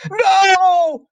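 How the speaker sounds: loud, very high wail; long, high shriek of dread; horrified cry. A narrator's reading. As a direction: cry out in shocked denial. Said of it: "she cried".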